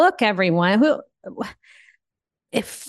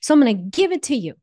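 higher, louder, impassioned declaration; passionate surrender; 5 semitones higher, 3 dB louder than usual. Acted. Declaring surrender to God to her cohost, higher and impassioned. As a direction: throw up your hands and let go.

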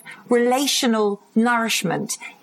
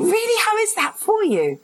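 eager high interest; 11 semitones higher than usual. Retold or imitated. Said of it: "I'm like".

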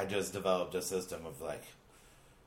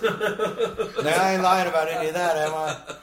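higher, louder put-on voice through laughter; mock pilot address; 7 semitones higher, 5 dB louder, slower than usual. Pilot bragging to passengers, hammed-up put-on voice. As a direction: ham it up like a shady pilot on the intercom. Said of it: "You stand up and go".

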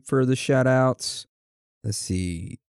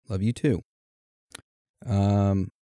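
usual speaking voice

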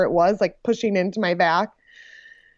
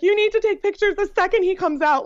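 urgent demand; 11 semitones higher than usual. Retold or imitated. Recalls insisting husband take photos.

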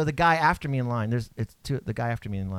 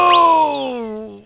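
mock ghost boo; 10 semitones higher, 10 dB louder than usual. Jump scare at start.

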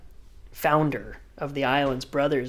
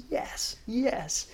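higher, soft triumphant hiss; quiet glee; 8 semitones higher, 6 dB quieter than usual. Retold or imitated. Reacting to Kelly saying it was worthwhile, quiet glee. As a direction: savor the win under his breath.

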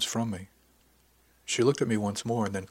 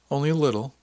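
raised, louder questioning push; pressing; 5 semitones higher, 7 dB louder, faster than usual. A narrator's reading. Narrator doubts her small fib, pressing. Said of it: "I pressed".

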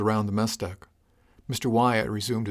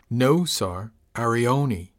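mildly exasperated correction; understated exasperation, leaning on each word; a bit exasperated; 4 dB louder, much slower than usual. A narrator's reading. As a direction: correct the captain with a touch of exasperation. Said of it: "He seemed a bit exasperated".

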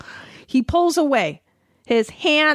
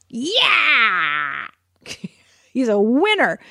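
mock cheer; 3 semitones higher, much slower than usual. Mocking hype about successful man.

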